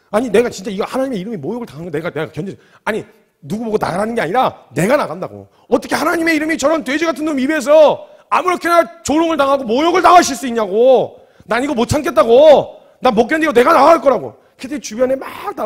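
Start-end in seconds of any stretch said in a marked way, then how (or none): 0.1-5.5 hurried protest, getting louder; agitated. Acted. David explaining himself to the king, agitated. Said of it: "흥분을 감추질 못하고 막 이야기를 하는". 5.7-14.3 high, very loud indignant outcry; worked-up shouting. Acted. David protesting the insult before the king, worked-up shouting. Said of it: "흥분을 감추질 못하고".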